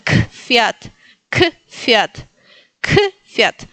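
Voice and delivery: "kfiat" as a separate K, then Fiat; K before Fiat. Shown as a demonstration. Teacher builds word for learners, K then Fiat in "kfiat". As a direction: set a detached K in front of "fiat" in "kfiat". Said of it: "y luego ponerle la K adelante".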